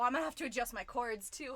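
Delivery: ordinary delivery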